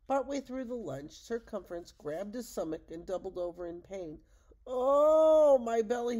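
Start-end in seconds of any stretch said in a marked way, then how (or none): 4.6-6.2 loud, high pained wail. Acted. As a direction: cry out as the belly burns. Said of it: "he wailed".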